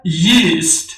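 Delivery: ییست sounded "yeest" for learners; English pronunciation demo. Shown as a demonstration. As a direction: say ییست as "yeast", with long ee.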